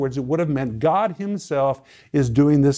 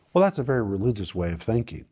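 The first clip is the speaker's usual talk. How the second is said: ordinary talk, nothing marked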